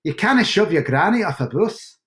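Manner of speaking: second-go mock Scottish accent. Shown as a demonstration. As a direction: try it as an amateur Scot. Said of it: "Try again".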